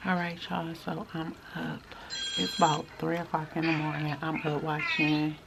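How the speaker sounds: hushed, very soft late-night whisper; real whisper. Real, not acted. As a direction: keep it down so nobody nearby hears. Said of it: "I'm whispering because".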